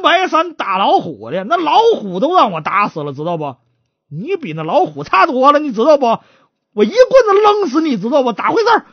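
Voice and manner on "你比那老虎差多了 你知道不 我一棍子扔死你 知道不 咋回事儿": raised-pitch acted threat; menacing boast. Tiger-killing magistrate threatening the suspect with a club, menacing. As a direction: intimidate him, then demand the truth.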